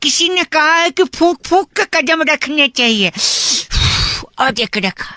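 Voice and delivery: explain, then blow before the step; puffing as he talks. Pretend. Prank caller explains his careful steps to a stranger, puffing between phrases. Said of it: "फूंक क्यों रहा हूँ".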